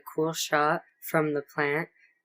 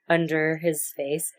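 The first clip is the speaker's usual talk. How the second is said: ordinary talk, nothing marked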